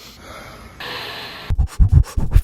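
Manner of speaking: long loud weary sigh; heavy dramatic exhale. Retold or imitated. Compilation of apology sighs.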